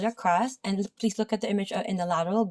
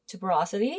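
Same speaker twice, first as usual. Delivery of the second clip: normal talking voice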